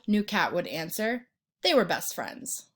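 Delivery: plain reading voice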